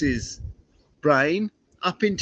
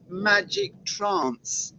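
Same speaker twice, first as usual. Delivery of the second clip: mock mystical; 9 semitones higher, 4 dB quieter, much slower than usual. Spell while riding past cows.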